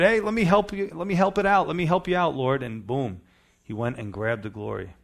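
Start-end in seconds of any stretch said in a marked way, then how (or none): 0.0-2.6 high, loud, eager offer to help; Uzzah's eager offer. Retold or imitated. The preacher voicing Uzzah reaching for the ark, eagerly. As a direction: pitch it up like a too-eager helper reaching out. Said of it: "And yet he figured".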